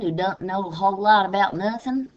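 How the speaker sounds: Southern bumpkin. Shown as a demonstration. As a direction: lightly play the country bumpkin. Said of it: "Southern country bumpkin".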